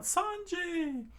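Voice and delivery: high, stretched call of a name; high anime crew scream; crew's cry. Acted. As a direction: call out to a fallen friend. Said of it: "the chef crew screamed out".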